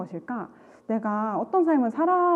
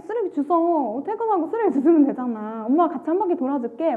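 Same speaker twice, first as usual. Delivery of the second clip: mother's voice; 6 semitones higher than usual. Retold or imitated. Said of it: "엄마가 이렇게 얘기해요".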